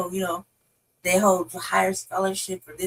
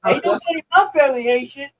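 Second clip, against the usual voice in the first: put-on Haitian accent, lightly; 5 semitones higher, 5 dB louder, faster than usual. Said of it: "I hear a Haitian accent".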